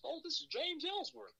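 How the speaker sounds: high, put-on caller voice; mock Ellsworth. Imitating James Ellsworth introducing himself on the phone, high put-on voice. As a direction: do a light impression of the caller.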